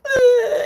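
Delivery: high loud fake crying wail; a hammed-up manipulative sob; mock sob. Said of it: "I wasn't crying to be like".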